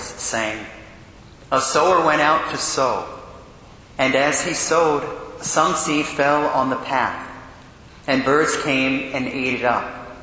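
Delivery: low, measured liturgical reading; solemn Gospel reading. Acted. Priest reads the Gospel.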